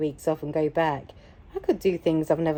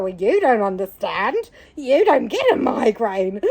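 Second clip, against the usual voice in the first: raised, accusing whine; mock blaming; 4 semitones higher, 4 dB louder than usual. Blaming the man on stage, mock accusing. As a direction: throw the blame at him, hammed-up. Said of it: "I was blaming him".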